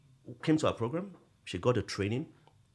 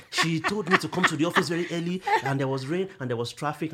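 louder, higher mock grumbling; indignant complaint; 5 semitones higher, 7 dB louder than usual.

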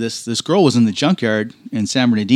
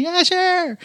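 very high, timid fan voice; mock shy fan. Starstruck fan answering the racer's photo offer, very high and timid. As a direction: squeak the yes like a starstruck kid.

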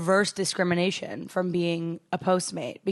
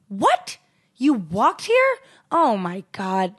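astonished retelling; 2 semitones higher, 4 dB louder, much slower than usual. Retold or imitated. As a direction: mimic an astonished customer. Retold customer astonished she walked.

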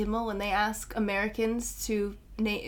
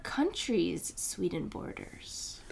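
hushed, slow question; slow whispered quiz question; 8 dB quieter, much slower than usual. Real, not acted.

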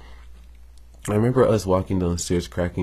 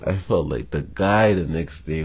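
ordinary talk, nothing marked

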